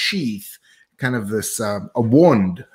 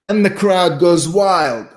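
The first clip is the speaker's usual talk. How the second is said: raised, louder mock commentary; mock crowd announcer; 7 semitones higher, 5 dB louder than usual. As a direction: hype it up like a commentator.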